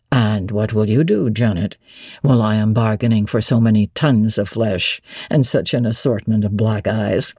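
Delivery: low and relishing every word; Rochester, delighted. A narrator's reading. Rochester delighting in Jane's spirited reply, low voice.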